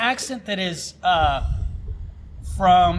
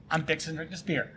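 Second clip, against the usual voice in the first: soft Southern "I'm fixing to drink this beer"; 2 semitones lower, 11 dB quieter than usual. Shown as a demonstration. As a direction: drop it into a Southern accent. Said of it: "way better than saying".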